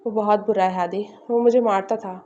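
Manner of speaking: plain reading voice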